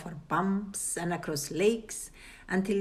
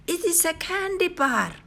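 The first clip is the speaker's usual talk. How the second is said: put-on excited girl; 11 semitones higher than usual. A narrator's reading. Cheryl sees candy bar.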